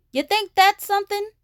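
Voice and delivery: put-on high excited boy cub; one-up her, voice jumping up high; cried out. A narrator's reading. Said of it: "cried Brother".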